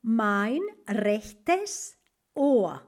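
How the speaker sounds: very slow, clear German for learners; teacher pacing out a German phrase; slow model phrase. Shown as a demonstration. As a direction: spell each word out slowly. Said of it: "slow German model phrase".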